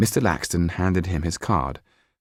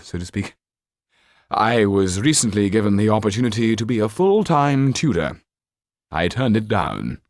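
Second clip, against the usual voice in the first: put-on theatrical old actor; 2 semitones higher, 3 dB louder than usual. Actor Trippin telling the banker about the boy, theatrical old actor. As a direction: declaim like a hammy actor.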